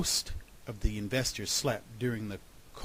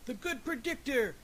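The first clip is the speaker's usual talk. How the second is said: cheering; 12 semitones higher than usual. A narrator's reading. Value investor as the groundhog runs by, cheering. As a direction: hail him as a hero. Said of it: "cheered the value investor".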